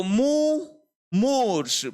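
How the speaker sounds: मुमूर्ष stretched high, "mu... mooorsh"; short then long. Shown as a demonstration. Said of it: "पहले लघु फिर दीर्घ". (मुमूर्ष, पहले छोटा मु फिर लंबा मू)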